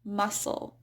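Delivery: "muscle" as MUSS-ul, no C sound; silent C in muscle. Shown as a demonstration. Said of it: "just a silent C".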